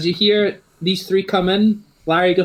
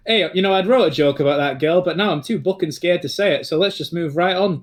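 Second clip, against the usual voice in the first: Northern English comic voice; 3 semitones higher than usual. Acted. Commentator Larry joking to the arriving students, Northern English voice. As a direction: crack wise like a Northern English announcer. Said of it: "kinda goes".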